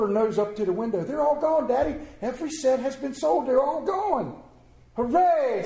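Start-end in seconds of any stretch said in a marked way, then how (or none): 1.0-4.4 put-on higher, eager child voice; excited little girl. Girl telling Daddy the sets are gone, high and eager. 4.9-5.6 higher, louder little hooray; cheer. Acted. Tommy cheering the empty window, loud and happy.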